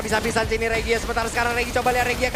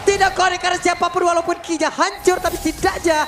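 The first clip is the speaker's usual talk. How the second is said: loud, fast teamfight call; esports caster; 4 semitones higher, 4 dB louder than usual. Real, not acted. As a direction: shout the fight call like it's live. Live play-by-play of a teamfight.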